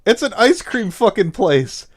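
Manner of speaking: ordinary talk, nothing marked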